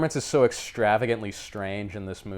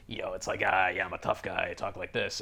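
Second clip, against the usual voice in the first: low, soft tough guy grumble, mocking; mock Guy Pearce's tough guy grumble; 4 semitones lower, 8 dB quieter than usual. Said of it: "he has the tough guy grumble".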